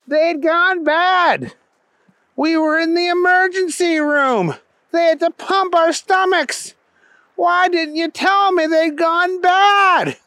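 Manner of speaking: high-pitched, drawn-out grievance; exasperated buddy, complaining. A narrator's reading. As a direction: complain loudly to your friend in a high voice. Keith complains about hot dogs.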